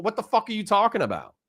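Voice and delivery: sudden jump up into exasperation; real mad outburst. Real, not acted. Streamer losing patience with viewer after apologising, exasperated. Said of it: "I'm sorry for getting mad".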